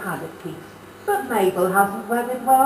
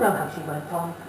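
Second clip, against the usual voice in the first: normal talking voice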